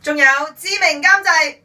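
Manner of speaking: high, loud, gasping from the icy drench; in ice cold water. Real, not acted. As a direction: shout through the freezing shock. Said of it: "好凍啊！".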